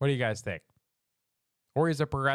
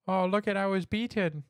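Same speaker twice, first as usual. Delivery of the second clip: higher mocking victim whine; mock whiny victim; 6 semitones higher than usual. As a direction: play the fake victim. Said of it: "mocking deportees' complaints".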